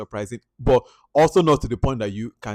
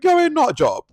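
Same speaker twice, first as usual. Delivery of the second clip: high, loud dismissive jeer; mocking onlookers; 8 dB louder than usual. Bystanders sneering at Laura, high mocking scoff. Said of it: "people were like".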